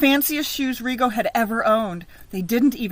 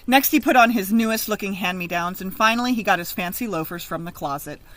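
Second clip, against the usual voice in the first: usual speaking voice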